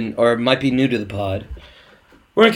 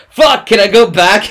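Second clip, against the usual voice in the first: alarmed; 8 semitones higher, 6 dB louder than usual. Retold or imitated. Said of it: "I was like".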